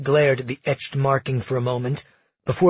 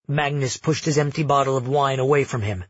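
usual speaking voice

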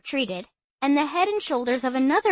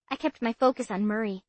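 usual speaking voice

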